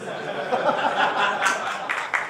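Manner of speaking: real airy burst of laughter; unvoiced chuckle of amusement; breathy laugh. Real, not acted. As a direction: let out a breathless laugh at the memory. Laughing at the border story.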